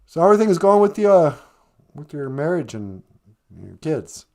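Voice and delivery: usual speaking voice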